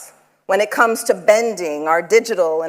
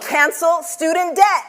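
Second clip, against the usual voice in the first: higher, punchy prompt; emphatic call-out; 6 semitones higher than usual. Acted. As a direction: throw it out to the room. Prompting audience call and response.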